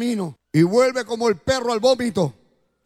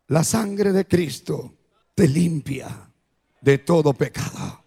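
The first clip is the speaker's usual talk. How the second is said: much lower, slow and gentle; hushed reassurance; 9 semitones lower, slower than usual. Shown as a demonstration. Pastor assuring the sinner of cleansing, slow and low. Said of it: "Es tan fácil".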